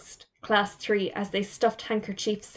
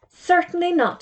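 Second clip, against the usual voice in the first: high indignant splutter; 9 semitones higher, 4 dB louder than usual. A narrator's reading. Teacher spluttering at Jody's wrong answer, high indignant splutter. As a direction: burst out high and offended. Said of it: "spluttered Mister Potter".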